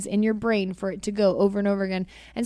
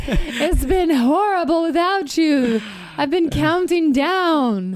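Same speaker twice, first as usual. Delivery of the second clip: slow, high and louder melodrama; mock lovesick drawl, much higher; hammed-up lovesick; 6 semitones higher, 4 dB louder, slower than usual. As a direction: overplay pining for a lover.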